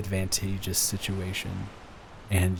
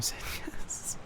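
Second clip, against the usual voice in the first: whispered with a small laugh; 11 dB quieter than usual. Real, not acted. To his fiancée right after she says yes, whispered. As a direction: breathe it to her, close and soft. Tender moment after proposal.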